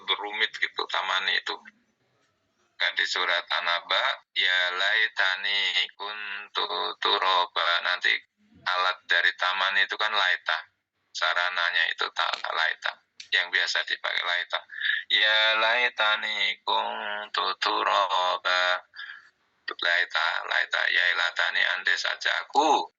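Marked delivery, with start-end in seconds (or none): 4.4-7.7 slow Quran recitation. Shown as a demonstration. Quotes verse from Surah An-Naba. 15.1-18.8 slow Quran recitation. Shown as a demonstration. Quotes same verse again.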